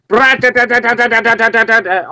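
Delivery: rapid-fire rat-a-tat on one held note; machine-gun stutter by mouth; imitated gunfire. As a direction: mimic a burst of automatic gunfire. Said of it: "you hear".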